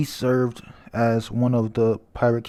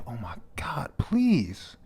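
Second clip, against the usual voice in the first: breathy exasperated whisper, pitch shooting up on please; hushed oh my god, please; whispered aside; 6 dB quieter, slower than usual. Real, not acted. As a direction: whisper it under your breath, pleading. Aside while reading the subtitles.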